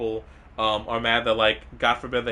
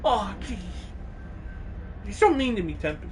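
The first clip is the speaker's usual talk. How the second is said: higher, whiny fake hurt; mock hurt; 7 semitones higher, 5 dB quieter than usual. Complaining to chatter Tempest, playful whiny hurt. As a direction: pout it out like you're wounded.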